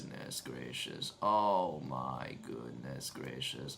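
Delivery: very low, soft put-on drone; low droning repetition. Repeats phrase in put-on deep drone.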